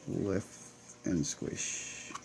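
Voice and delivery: a soft voiceless "lift and squish"; whispered repeat. Real, not acted. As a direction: breathe the phrase to yourself. Repeats catchphrase under breath.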